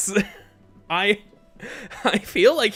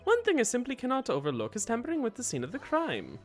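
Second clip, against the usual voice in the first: soft, swooping put-on killer voice; accented hitman character; 4 dB quieter, faster than usual. Killer testifies in court, soft accented character voice. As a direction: play a polite killer with an accent, softly.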